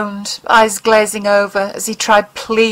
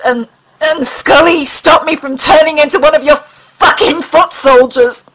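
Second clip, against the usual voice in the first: higher, louder, slowing on foot soldiers; stammering anger; 7 semitones higher, 4 dB louder than usual. Acted. Mulder tells the alien Scully saved him, higher and angry. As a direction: stumble then spit out the last words. Mulder rages at his captor.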